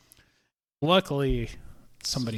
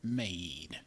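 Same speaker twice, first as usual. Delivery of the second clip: mock snooty customer; 2 semitones lower, slower than usual. Barista imitating a rude customer, slow and hushed. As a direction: hiss it like a prim, demanding customer. Angry customer quoted in whisper.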